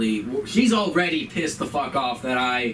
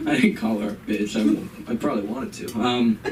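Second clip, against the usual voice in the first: ordinary talk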